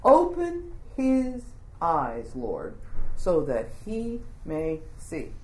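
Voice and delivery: slow, soft, measured plea; solemn prayer. Acted. Elisha praying for his servant, slow and solemn. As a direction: pray it slowly, dropping low on Lord. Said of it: "says in his prayer".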